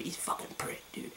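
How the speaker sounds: whispered insult; muttered insult. Real, not acted. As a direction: hiss it under your breath. Venting about [name].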